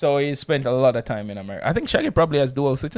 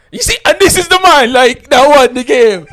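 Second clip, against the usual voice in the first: laughing hype; 10 semitones higher, 10 dB louder than usual. Real, not acted. Host hyping the winner, laughing.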